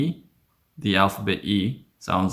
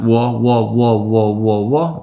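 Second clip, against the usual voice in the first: "wo" repeated quickly and a bit louder; said really fast; 6 dB louder than usual. Shown as a demonstration. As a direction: rattle off "wo" fast, over and over. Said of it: "If you say it really fast".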